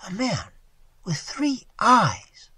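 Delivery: high, slow, astonished echo; incredulous. Acted. Waitress echoes Tigertail's claim, incredulous. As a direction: draw it out in disbelief.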